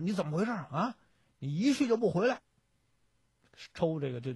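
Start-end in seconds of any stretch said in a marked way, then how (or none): 0.0-2.4 抬高音调责骂质问，句尾加重; 演一个将军劈头训斥部下. Acted. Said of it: "这个副将军大骂说". (提高嗓门厉声责问)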